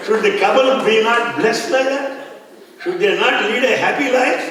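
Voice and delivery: rally the crowd behind you; mock indignant shout. Devotee protesting to the hall, loud mock indignation.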